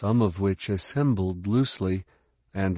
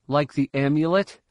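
higher, pointed question; doubting pupil; 6 semitones higher, 3 dB louder than usual. A narrator's reading. Pupil sceptical of mentor's new mission, doubting. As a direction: ask it with a rising challenge.

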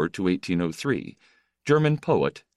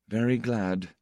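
dry, minimal greeting; 3 semitones higher than usual. A narrator's reading. Famous writer greets a newcomer, drily. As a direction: say it drily, without welcome. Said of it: "he said in a dry voice".

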